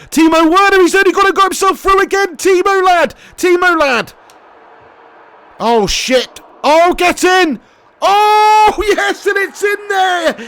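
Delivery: high, loud, cheering the goal; yelling with joy as the striker scores; excited goal shout. Real, not acted. Streamer cheering his striker's goal, high and loud.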